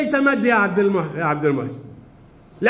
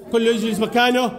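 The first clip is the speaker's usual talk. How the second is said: ordinary talk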